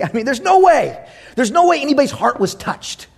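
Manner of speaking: high-pitched, emphatic disbelief; worked-up mock objection; incredulous protest. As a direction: scoff at the very idea.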